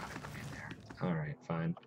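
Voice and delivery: soft unvoiced whisper. Streamer sneaking through the inn, whispering. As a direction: hush it like you might get caught.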